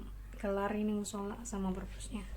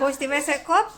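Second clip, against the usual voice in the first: loud call from another room; yelling across the room; 6 semitones higher, 14 dB louder than usual. Real, not acted. Calling to child elsewhere.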